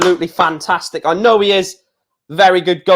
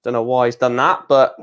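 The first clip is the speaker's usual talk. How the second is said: usual speaking voice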